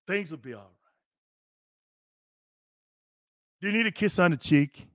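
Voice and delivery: baby him in a hushed, fake-sweet voice; mock tender. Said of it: "This is what I would do".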